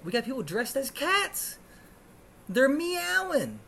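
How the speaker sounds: high-pitched exasperated exclamation; worked-up comic outrage; incredulous rant. Real, not acted. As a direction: rise into outraged disbelief, stretching the last word. Ranting in disbelief at cat costume.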